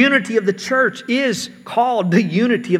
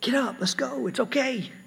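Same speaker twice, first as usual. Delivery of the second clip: soft, higher-pitched murmur of reassurance; imitated encouraging whisper; 5 semitones higher, 6 dB quieter than usual. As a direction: softly encourage a hurt child, half-whispered. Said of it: "you whisper in their ear and you encourage".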